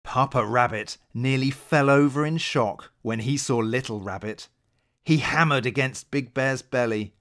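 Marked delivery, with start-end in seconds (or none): none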